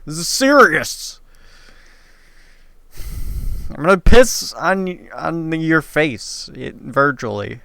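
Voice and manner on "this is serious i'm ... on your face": fighting back laughter, louder and higher; mock serious. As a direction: try to sound serious, holding back a laugh. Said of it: "How can I say that without laughing?".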